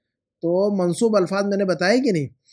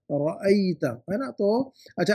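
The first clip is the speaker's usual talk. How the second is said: ordinary talk, nothing marked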